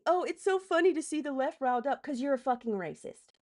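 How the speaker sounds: sneering imitation of a gloating troll; mocking quote. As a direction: parrot the commenter with scorn. Mockingly quotes a hostile commenter.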